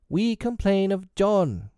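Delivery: put-on high, drawn-out childish complaint; high-pitched twins' protest; crying out. A narrator's reading. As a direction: wail the complaint slowly, like tattling children. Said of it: "cried the twins".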